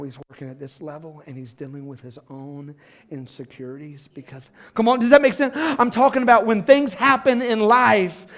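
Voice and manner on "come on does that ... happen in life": loud, high, driving sermon climax; preaching at full force. Acted. Pastor lifting the church, loud preaching. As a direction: pour it on the congregation, full voice.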